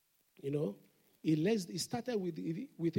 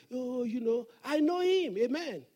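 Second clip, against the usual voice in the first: a hint of showy fake humility; mock false humility; 4 semitones higher, 6 dB louder than usual. Preacher mocking falsely humble believers. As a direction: pitch up, bragging while acting humble. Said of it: "There's some people, they have false humility".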